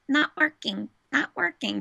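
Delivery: high-pitched mimicked toddler talk; little-kid impression, high; imitating toddler nephew. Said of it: "his first thing was".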